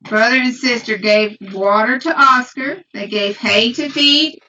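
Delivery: storybook read-aloud voice to little ones. Real, not acted. Mother reading a farm book to her children, storybook voice.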